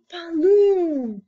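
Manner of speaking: high, loud mock gunshot; booming gun noise. A narrator's reading. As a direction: blast it out like a big gun going off. Gun fires again.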